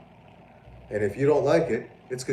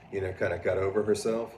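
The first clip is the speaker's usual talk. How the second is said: ordinary talk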